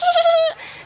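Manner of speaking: laugh after voice. Real, not acted.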